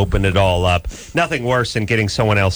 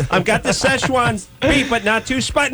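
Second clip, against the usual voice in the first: pleading customer; 12 semitones higher than usual. Retold or imitated. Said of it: "So, so it's".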